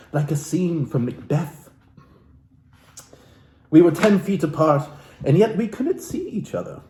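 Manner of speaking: lightly theatrical book reading; a hint of stage reading from the book; dramatic reading. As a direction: read the passage like a soulful memoir. Continues reading the book aloud.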